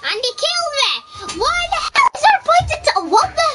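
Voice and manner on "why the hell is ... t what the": high, loud shout that cracks; voice cracking. Real, not acted. Gamer killed again, shouting with a voice crack. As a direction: yell until the voice breaks. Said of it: "Voice crack it again".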